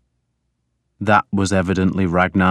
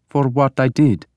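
higher, timid answer; fearful; 6 semitones higher than usual. A narrator's reading. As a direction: answer meekly with a touch of fear. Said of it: "shaking with fear".

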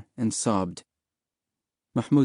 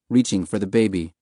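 plain reading voice

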